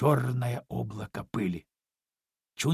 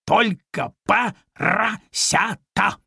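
slow, weighty, higher cat voice with a rising end; imposing cat; 6 semitones higher, 5 dB louder, much slower than usual. Acted. Cat lecturing the piglet, slow and imposing. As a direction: drag it out grandly like a pompous cat. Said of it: "внушительно повторил кот".

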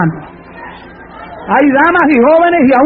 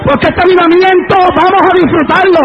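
full-force preaching; 6 semitones higher than usual. Acted. Preacher defying the devil who wants a silent church, full-force preaching. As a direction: build it toward a shout.

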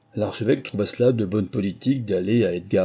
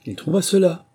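impatient, high, louder reply; 9 semitones higher, 4 dB louder than usual. A narrator's reading. Mouse answering the duck's interruption with impatience. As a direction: snap the words with irritation. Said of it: "répondit la souris avec impatience".

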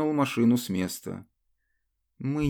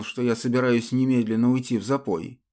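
ordinary delivery

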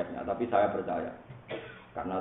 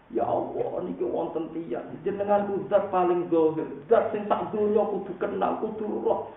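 high tearful wail, building louder; mock sobbing plea; 11 semitones higher, 4 dB louder than usual. Rabi'ah complaining to God, in mock sobs. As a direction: imitate a weeping complaint, climbing to a loud peak. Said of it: "nangis terseduh-seduh".

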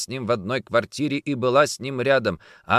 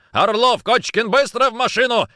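high, loud urgent call; shouting orders; 10 semitones higher, 4 dB louder than usual. Acted. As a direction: yell down the corridor. Calling out to assistants urgently. (громкий приказ)

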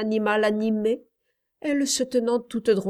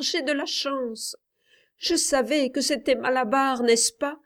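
high, strained excited calls; cling to the win in delirium; feverish elation; 6 semitones higher than usual. A narrator's reading. Dying boy telling his mother he was lucky, feverish and elated.

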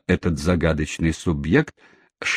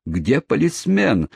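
mild mock shout; 7 semitones higher, slower than usual. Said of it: "закричал мистер Снонграсс".

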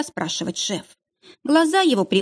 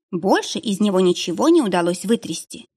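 ordinary delivery

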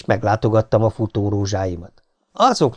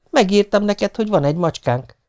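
higher-pitched wifely voice; wife explaining; 9 semitones higher than usual. A narrator's reading.

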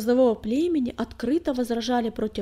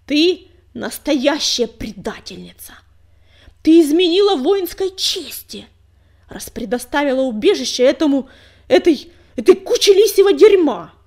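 seething anger; 4 semitones higher, 13 dB louder than usual. A narrator's reading. Enraged rival accuses leader.

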